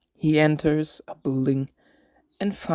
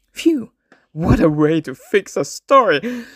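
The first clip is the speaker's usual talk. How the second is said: high, loud burst of relief; relieved exclamation; 5 semitones higher, 7 dB louder than usual. Acted. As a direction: cheer the lucky plot fix out loud. Narrator exclaims about fixing story.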